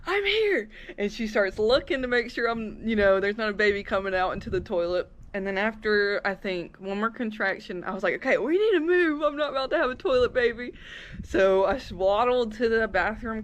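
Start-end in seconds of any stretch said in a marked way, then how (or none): 0.0-0.7 urgent reassurance. Retold or imitated. Midwife rushing in, quoted. 8.2-10.7 urgent, panicky. Retold or imitated. Urgent labor decision, quoted.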